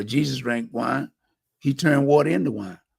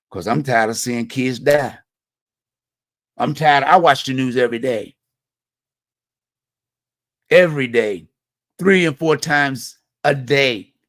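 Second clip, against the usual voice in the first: louder, heated insistence; real frustration pushing the voice up in level; worked-up and tired of it; carried away; 4 dB louder than usual. Real, not acted. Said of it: "I know I get carried away".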